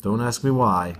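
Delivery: plain reading voice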